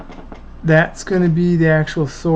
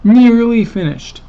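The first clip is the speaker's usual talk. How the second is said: higher, louder proud repeat; excited; 7 semitones higher, 7 dB louder than usual. Real, not acted. Repeats the news, still excited.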